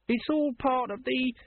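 high monotone, stiff recital; slow, stilted parroting; wooden. A narrator's reading. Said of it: "said his assistant woodenly".

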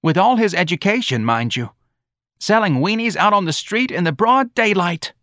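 high, indignant lament; scandalised mother. Acted. Mrs. Riley lamenting her educated son's job, scandalised. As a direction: wail lightly over the disgrace.

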